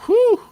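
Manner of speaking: very high loud whew; high whistle of admiration. Retold or imitated. Curly Q sees a handsome guy, loud high whew. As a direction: let out an impressed high whew. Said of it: "Curly Q looks at him and goes".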